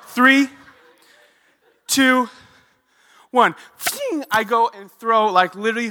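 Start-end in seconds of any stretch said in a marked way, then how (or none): 0.0-3.5 loud, high count with long suspenseful pauses; dramatic countdown. Retold or imitated. Psyching himself up to grab shrimp, dramatic loud countdown. 3.8-4.2 very high, ringing "fling"; imitated flinging noise. Shrimp flying from the bucket, high-pitched flinging noise.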